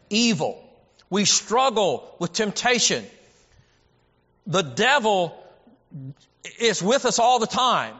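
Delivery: loud, high, driving preacher cadence; full-force preaching. Acted. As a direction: preach it hard to the congregation, pressing each line.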